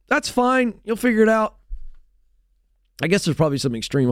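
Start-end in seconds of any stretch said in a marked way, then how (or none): 0.1-1.6 high, airy brush-off from a dad; dad brushing off his kid's worry, high and breezy; dismissive dad. Retold or imitated. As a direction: wave the kid off lightly, pitched up.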